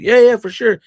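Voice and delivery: put-on nervous kid jumping to agree; eager yes. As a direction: gush your yes like a starstruck kid. Starstruck kid answering eagerly.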